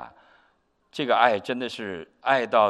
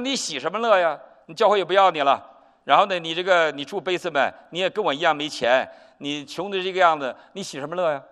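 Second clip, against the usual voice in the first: mocking retort; 6 semitones higher, 5 dB louder than usual. Mocking the broke pastor's joy.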